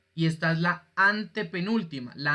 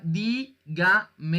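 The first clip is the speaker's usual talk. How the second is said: "dígamelo" split slowly into dí-ga-me-lo; slow classroom syllable count on "dígamelo"; 2 semitones higher, 3 dB louder, slower than usual. Shown as a demonstration. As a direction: sound out "dígamelo" one syllable at a time. Teacher splits the word into syllables.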